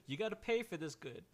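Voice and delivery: Jenny insisting. Acted. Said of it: "she goes".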